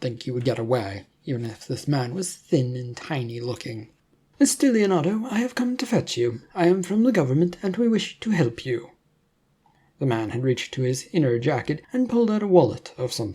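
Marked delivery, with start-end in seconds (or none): 4.4-8.9 higher, brisk official voice of a government man; a slick agent pressing his offer, pitched up. A narrator's reading. Government agent confronts Leonardo.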